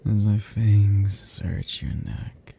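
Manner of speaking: ordinary talk, nothing marked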